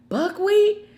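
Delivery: high mocking Buckwheat; mocking question. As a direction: sneer the word. Mocking the word.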